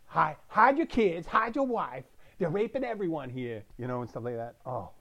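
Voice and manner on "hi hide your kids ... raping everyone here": hammed-up urgent warning to the neighbourhood; imitating viral interview. Retelling a viral news clip, a hammed-up higher, louder warning.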